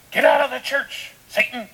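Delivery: high, booming hammed-up banishment; over-the-top, full-volume mock rebuke; mock furious shout. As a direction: shout it like casting out the devil.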